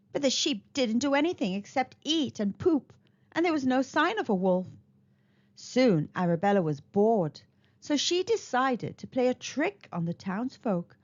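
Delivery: ordinary delivery, nothing marked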